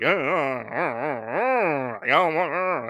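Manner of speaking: long wavering mock moans; hammed-up wordless wailing; imitated panicky groans. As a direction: moan along like the frightened crowd. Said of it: "they start to go like".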